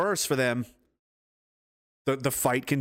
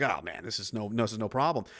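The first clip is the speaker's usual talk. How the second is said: much lower and softer smug dismissal; 6 semitones lower, 5 dB quieter than usual. Retold or imitated. The opponent early in the fight, lower and smugly relaxed. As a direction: brush it off like it's nothing. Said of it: "They're like".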